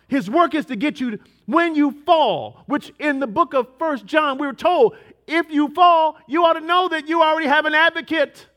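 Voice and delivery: high, loud preaching build; full-force preaching. Acted. Pastor preaches to his church about the enemy, loud and rising. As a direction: drive it home to the congregation.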